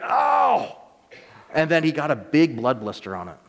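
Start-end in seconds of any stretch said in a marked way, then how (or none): 0.0-0.8 sudden high yelp of pain; mock pained cry after hammering his thumb. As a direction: yelp like you just hit your thumb. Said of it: "but he was like".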